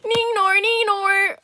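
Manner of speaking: loud, high Australian "Neenor, Neenor"; Australian accent. Pretend. Greeting a super chatter in a high Australian accent. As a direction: sing out the name in a high Aussie twang. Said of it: "I have to say it with an Australian accent".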